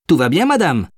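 high-pitched voice of a concerned employee; employé inquiet. A narrator's reading. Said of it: "lui demanda Takumi, voyant qu'elle était sous le choc". (voix aiguë d'employé inquiet)

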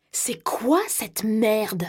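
hissed under the breath, slow; threatening whisper, drawn out; whispered menace. A narrator's reading. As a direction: spit it out in a whisper. Same quote, now whispered.

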